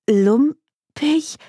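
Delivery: dumbfounded. A narrator's reading. Client repeating the detective's insult, dumbfounded. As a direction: echo the insult, stunned. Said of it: "Nayi war sprachlos".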